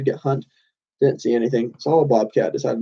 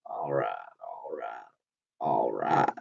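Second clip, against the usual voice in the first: deep, slow Texan drawl, much lower and softer; McConaughey drawl; 13 semitones lower, 12 dB quieter, slower than usual. Retold or imitated. Imitating the actor's famous line in a low slow drawl. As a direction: drop way down and stretch it like McConaughey. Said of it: "I can't, like, do his accent".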